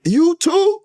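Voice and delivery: high, stunned question rising to a peak; can't believe your friend is here too; shocked disbelief. Retold or imitated. Seeing his friend in the cell, stunned and high. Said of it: "I say".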